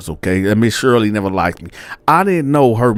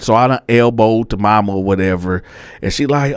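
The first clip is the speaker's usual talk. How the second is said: ordinary delivery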